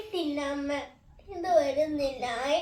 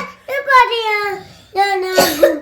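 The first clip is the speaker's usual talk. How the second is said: louder, crying out the words; tearful protest; 8 dB louder, slower than usual. Real, not acted. Girl crying out to mother about her tooth, loud and tearful. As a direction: protest through tears.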